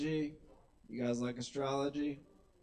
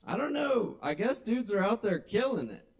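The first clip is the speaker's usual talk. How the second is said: much higher, mock-baffled squeak; sarcastic high voice; 7 semitones higher, 3 dB louder than usual. Real, not acted. Comic mocking guys who succeed on apps, high and sarcastic. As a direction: pretend to be in awe.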